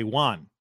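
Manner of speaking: JUAN like wand without the d; imitated mispronunciation. Host mimicking his co-host's WON, imitated mispronunciation as JUAN. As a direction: say Juan with an ah vowel, standing in for won. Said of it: "like you're saying wand without the D".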